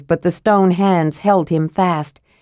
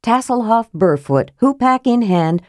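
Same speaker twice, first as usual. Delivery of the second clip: ordinary delivery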